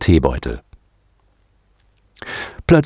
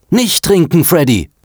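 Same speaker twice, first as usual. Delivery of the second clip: high urgent shout; gebrüllte Warnung; 11 semitones higher than usual. A narrator's reading. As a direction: call out frantically to stop him. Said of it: "brüllte er". (hoch und dringlich gerufen)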